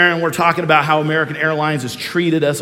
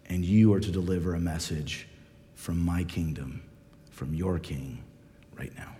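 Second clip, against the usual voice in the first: voice of God; 6 semitones lower, 7 dB quieter than usual. Retold or imitated. God gently correcting the angry traveler, hushed and low. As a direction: speak it like a quiet voice inside the heart, sinking to almost nothing. God's inner voice speaks to him.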